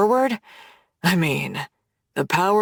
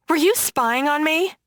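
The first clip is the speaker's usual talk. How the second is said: sharp, higher accusing snap; snapping accusation; 7 semitones higher than usual. A narrator's reading. Woman confronting the man who tracked her down, snapping angrily. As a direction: fire the question back at him, pitched up. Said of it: "I snap".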